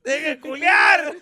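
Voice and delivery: loud, slow laughing Spanish shout; mock shout. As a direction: yell it out laughing.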